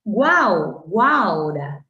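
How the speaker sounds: "Guau, guaura" punched loudly on guAU; onomatopoeic triphthong. Shown as a demonstration. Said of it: "esas onomatopeyas te van a permitir". («Guau, guaura» fuerte, marcando el triptongo uau)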